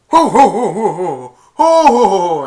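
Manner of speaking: high, loud mock Santa laugh. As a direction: boom out a jolly ho ho ho.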